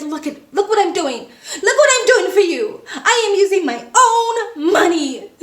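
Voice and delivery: lightly voiced, hammed-up showy generosity, laughing; telenovela drama. Airport guy showing off paying her fee, mock dramatic. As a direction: play the noble martyr with a laugh. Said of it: "the most telenovela dramatic performance".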